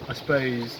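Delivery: ordinary talk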